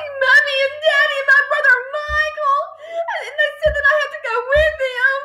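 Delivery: put-on high, wailing girl's voice; near-tearful, breathless high pitch; distressed child. A narrator's reading. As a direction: wail the news to your teacher. Distraught Matilda explains to teacher.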